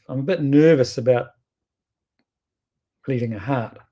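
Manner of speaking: ordinary delivery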